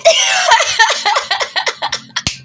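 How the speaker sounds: long, high belly laugh; big laugh. Real, not acted. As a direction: burst out laughing and let it run on. Cracks up at own bit.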